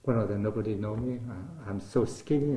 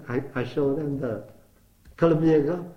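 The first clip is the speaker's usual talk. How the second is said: ordinary delivery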